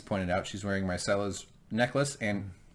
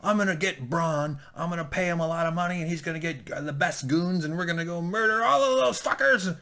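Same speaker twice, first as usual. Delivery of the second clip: much higher, hammed-up furious rant; a lot higher mock Jaime plotting revenge; mock angry Jaime; 9 semitones higher than usual. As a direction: play the scheming hothead, pitched way up. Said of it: "So he goes".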